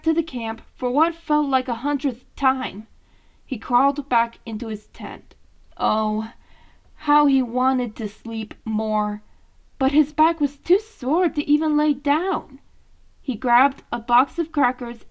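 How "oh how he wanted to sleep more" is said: ordinary delivery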